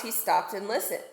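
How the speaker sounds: plain reading voice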